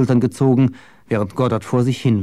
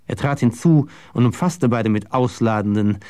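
ordinary delivery, nothing marked

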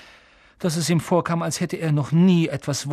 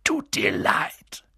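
barked, drawn-out low giant growl; Hagrid; 5 semitones lower, much slower than usual. A narrator's reading. Giant throwing the boy's apology back, barking. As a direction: growl it slowly in low, voiceless disbelief. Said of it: "bellte Hagrid".

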